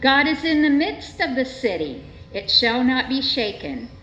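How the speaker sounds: ordinary talk, nothing marked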